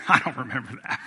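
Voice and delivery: chuckling through it, lightly voiced dad; laughing denial. Dad laughing off the memory at Christmas, chuckling. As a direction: laugh it off like you forgot. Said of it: "He just laughs and goes".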